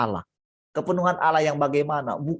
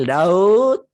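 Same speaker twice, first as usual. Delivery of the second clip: calling out; 8 dB louder than usual. Acted. Father calling his son.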